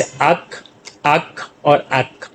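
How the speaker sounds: अक को धीमे और नरम बोलें, आख़िर में क को हल्का-सा रोक दें; हर अक के बाद रुकें और उसे दिखाकर बोलें; के की अंतिम ध्वनि. Shown as a demonstration. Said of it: "यानी के की साउंड". (अक, अक और अक को बहुत धीमे, अलग-अलग बोलें)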